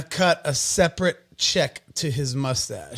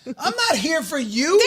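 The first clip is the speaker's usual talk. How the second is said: high, fast sassy retort; sassy defiant; 10 semitones higher than usual. Acted. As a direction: toss it off like you don't care. Defiant joking rant.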